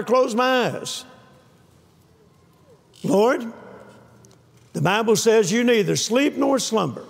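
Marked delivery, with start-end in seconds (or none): none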